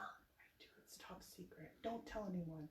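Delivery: conspiratorial top-secret whisper; very hushed, barely audible. Real, not acted. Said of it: "You don't have to whisper".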